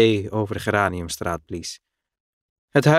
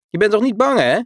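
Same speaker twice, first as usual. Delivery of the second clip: put-on high, loud, teasing boy's call; 11 semitones higher, 5 dB louder, faster than usual. A narrator's reading. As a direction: call out to tease him from the barrel, much higher. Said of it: "riep Billy vanaf zijn vat". (hoog, luid en plagerig roepen)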